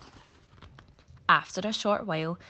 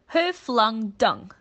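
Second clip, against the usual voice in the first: slow, louder demand, punching who; 5 semitones higher, 8 dB louder, slower than usual. Acted. Monkey demands an answer from the lion, slow and loud. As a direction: insist on knowing. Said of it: "Farley demanded to know".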